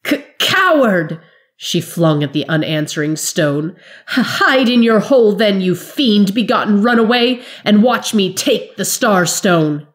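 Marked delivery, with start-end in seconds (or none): 0.0-1.2 high, slow, stammering cry of rage; furious sob at the empty stone; sobbing fury. A narrator's reading. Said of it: "sobbing with fury and pain". 4.2-9.9 high, loud, stammered taunt through tears; raging defiance choked with pain; sobbing fury. A narrator's reading. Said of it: "sobbing with fury and pain".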